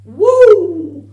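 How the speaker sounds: loud, high, long woo; piercingly loud excited holler; manic whoop. Acted. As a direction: let out a huge high whoop. Whoop amid manic rant.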